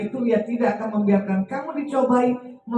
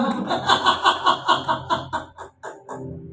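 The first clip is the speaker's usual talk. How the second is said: airy high giggle; breathless laugh; 8 semitones higher than usual. Real, not acted. Preacher laughing at his own punchline, airy giggle.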